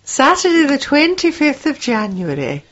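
high, put-on Scottish lilt; bad Scottish accent. Shown as a demonstration. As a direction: give the announcement a mock-Scottish voice. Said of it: "That is my Scottish accent".

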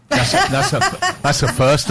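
high laughing disbelief. Real, not acted. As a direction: laugh it out in amused surprise. Laughing at silent seminar.

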